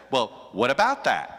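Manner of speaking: sarcastic whataboutism retort; mock supporter. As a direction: toss it off like a partisan deflecting. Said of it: "his supporters will say".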